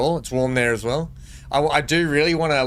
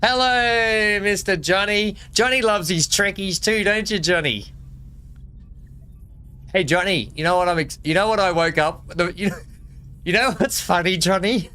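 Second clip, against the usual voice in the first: playful greeting; 6 semitones higher than usual. Pretend. Greeting viewer Johnny in sing-song voice.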